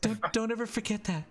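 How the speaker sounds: high, halting, earnest voice; Danny. Acted. Danny pleading for Voldo's trust, high and earnest. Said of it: "she goes".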